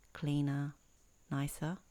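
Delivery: ordinary talk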